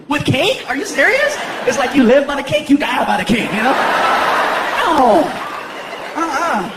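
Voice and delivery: put-on girlfriend arguing. Girlfriend arguing about poisoned cake, high and incredulous. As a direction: voice the girlfriend winding up.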